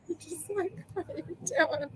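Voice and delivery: high, soft, squeezed out through laughter; words nearly lost in a giggle; laughing. Real, not acted. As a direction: barely get it out for laughing. Laughing at her own behavior.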